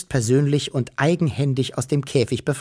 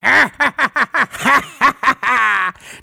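hohes, lautes Monsterlachen; grässliches Lachen; 11 semitones higher, 5 dB louder than usual. A narrator's reading. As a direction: ein krächzendes Schurkenlachen. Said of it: "fing er krächzend und grässlich an zu lachen". (lautes, krächzendes Monsterlachen)

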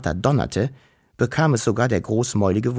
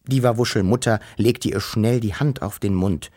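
ordinary delivery, nothing marked

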